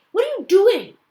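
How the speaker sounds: put-on a nervous young cop losing patience; annoyed. A narrator's reading. Skinny officer annoyed at his partner for getting out, loud and high. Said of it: "he looks annoyed".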